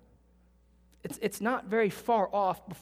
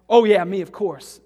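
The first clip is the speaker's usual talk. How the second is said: smug mock pride; 14 dB louder than usual. Mocking proud attitude.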